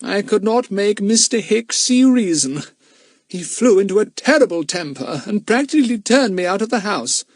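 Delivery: put-on high-pitched agitated old-fashioned gentleman; high, upset storybook voice; flustered Mister Smelly. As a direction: recount a fright in a high character voice.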